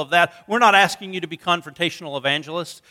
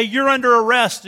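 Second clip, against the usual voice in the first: louder, higher cop command; police; 6 semitones higher, 6 dB louder than usual. Pretend. Police bursting in tell you you're under arrest, loud and higher. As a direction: bark it like a cop at the door. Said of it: "they come in and they say".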